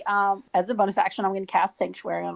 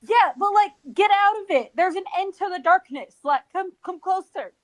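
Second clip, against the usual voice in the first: calling out; 9 semitones higher than usual. Acted. Calling through darkness to allies.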